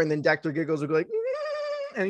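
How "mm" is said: very high, soft squealed hum; creepy little high giggle noise; mock giggle. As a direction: squeeze out a tiny high-pitched titter. Imitating Doctor Giggles's giggle.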